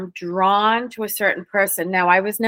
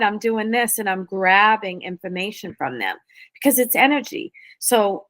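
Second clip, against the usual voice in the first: usual speaking voice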